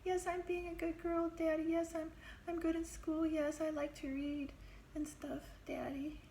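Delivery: put-on high, sweet good-girl voice, fading to a whisper; little girl voice. Girl assuring her jailed father she's good, high and babyish. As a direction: sound like a much younger, eager-to-please child. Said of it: "It's so different".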